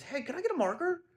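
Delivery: put-on high, quick little-kid voice; kid asking. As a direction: chirp it like a small boy asking politely. Son asks boy for marker.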